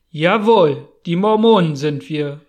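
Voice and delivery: lauter, stolzer Ruf; im Chor gerufen. A narrator's reading. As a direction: ruf es stolz wie eine ganze Gruppe. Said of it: "riefen alle einstimmig". (stolz und laut gerufen)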